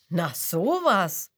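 put-on a slow, booming old fox; grandpa fox. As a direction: grandfather fox wondering aloud, slowly. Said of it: "sagt doch Opa Fuchs".

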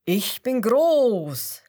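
slow, loud, booming big-crocodile call; slow boastful crocodile voice; first crocodile calling. A narrator's reading. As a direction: call it out slowly, proud and big. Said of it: "Das erste ruft".

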